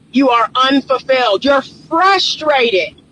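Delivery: normal talking voice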